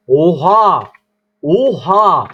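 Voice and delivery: loud shocked cry; shocked exclamation. Real, not acted. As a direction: blurt out disbelief. Shocked at the lag. (şaşkın, yüksek sesli haykırış)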